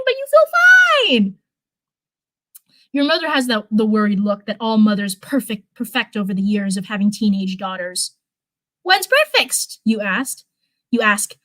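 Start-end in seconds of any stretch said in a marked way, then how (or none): 0.0-1.4 very high, slow mock-bright; forced cheer. A narrator's reading. Narrator echoing the fake cheer, very high. 8.8-9.8 higher, bright daughter question; forced casual. A narrator's reading. Daughter to mother, forced casual.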